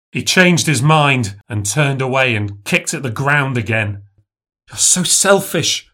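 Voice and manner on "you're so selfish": put-on high childish scolding; little girl scolding the fox boy. A narrator's reading. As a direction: tell him off like a small bossy girl. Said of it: "the girl said".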